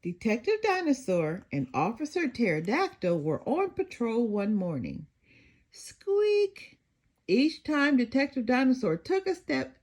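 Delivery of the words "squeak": high squeak; squeaky shoe noise. Acted. Narrator voicing the shoe with a high squeak.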